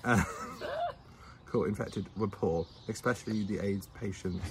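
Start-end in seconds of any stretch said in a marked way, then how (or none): 0.3-0.9 high laugh. Real, not acted. Laughing at her mistake.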